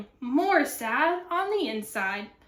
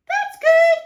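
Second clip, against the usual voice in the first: very high, loud squeak; lizard voice; 11 dB louder than usual. Acted. Lizard answering grumpy cactus, high and squeaky. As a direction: pipe up as a tiny lizard.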